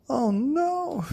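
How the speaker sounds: high loud despairing Oh no; fed-up bear wails; exasperated groan. Acted. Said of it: "said Daddy Bear".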